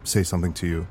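ordinary delivery

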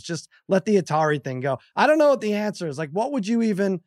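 ordinary talk

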